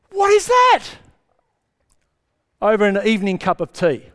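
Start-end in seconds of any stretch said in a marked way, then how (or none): 0.1-0.8 high, alarmed what is that; high startled villager; startled question. Retold or imitated. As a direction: jump at a strange noise.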